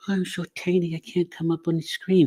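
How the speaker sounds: high, small timid voice; mock timid voice. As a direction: squeak it like someone tiny. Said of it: "have gotta stop playing".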